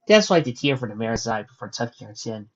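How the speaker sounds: usual speaking voice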